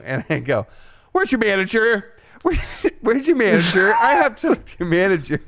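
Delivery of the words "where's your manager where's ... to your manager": demanding manager. Retold or imitated.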